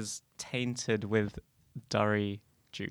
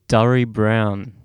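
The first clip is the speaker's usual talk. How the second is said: dramatic reveal; 10 dB louder, slower than usual. Acted. Dramatic reveal of favourite colour.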